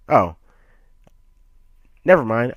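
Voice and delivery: normal talking voice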